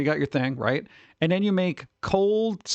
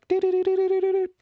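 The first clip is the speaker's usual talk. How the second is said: very high fast beeping noise; sugar rush beeping; faster than usual. Retold or imitated. Streamer mimics a glycemic spike with very high fast beeps. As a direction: imitate a sugar spike alarm, high and quick.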